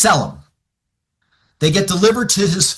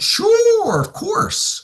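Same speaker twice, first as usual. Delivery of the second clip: imitated high, drawn-out yes; eager agreement; much slower than usual. Client agreeing to ask his brother-in-law, high and eager. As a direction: squeak out an over-willing agreement.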